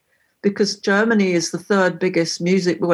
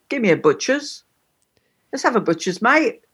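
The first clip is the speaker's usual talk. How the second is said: a lightly Cockney "Give me a butcher's", mate lifted high; Cockney slang; 4 semitones higher than usual. Shown as a demonstration. Showing a friend Cockney slang, cheeky lightly Cockney ask. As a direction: coax like a London mate wanting a peek. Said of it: "I'd say".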